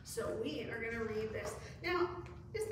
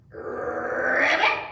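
loud rolled ribbit, rising; pig's frog croak; 11 dB louder than usual. A narrator's reading. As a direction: croak it with a long trilled R. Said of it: "the little pig answered".

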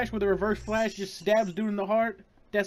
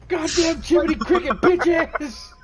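angry laughing yell; 7 semitones higher, 6 dB louder than usual. Real, not acted. Cursing the boss, laughing.

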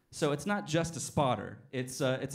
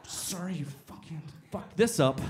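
mock rant; 3 semitones higher, 3 dB louder than usual. Ranting at the machine.

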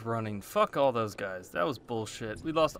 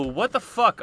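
angry outburst; 9 semitones higher, 7 dB louder than usual. Real, not acted. Angry reaction after getting hit.

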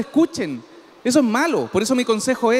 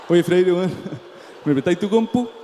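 mooching friend voice; 5 semitones lower than usual. Acted. Friend asking to borrow computer.

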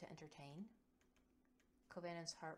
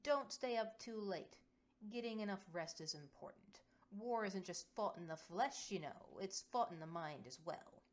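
louder announcing voice; 3 semitones higher, 7 dB louder than usual. Acted. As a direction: project to the room. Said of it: "announces in a volume they all can hear".

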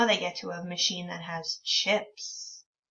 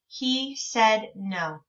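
slow reading; much slower than usual. Shown as a demonstration. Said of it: "reading aloud to children".

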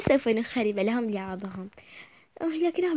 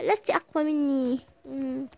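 usual speaking voice

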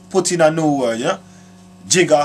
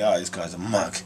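low, quiet scornful sneer; muttered mockery of onlookers; lightly voiced low mockery; mocking onlookers; 9 semitones lower, 8 dB quieter than usual. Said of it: "they laugh at you and say".

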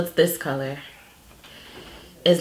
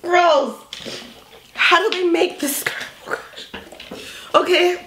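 grossed out; 9 semitones higher than usual. Real, not acted. Still reeling from skunk bean.

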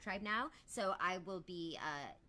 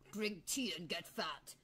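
slow, lightly voiced old uncle; a hint of the lazy red dragon giving advice, slowly; imitating Uncle; slower than usual. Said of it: "the red dragon's like".

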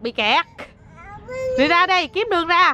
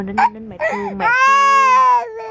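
soothing; 12 semitones higher, 4 dB louder than usual. Real, not acted. Soothing crying child.

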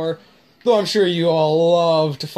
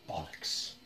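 muttering under breath; 5 semitones higher, 19 dB quieter, slower than usual. Real, not acted. Cursing under breath at game.

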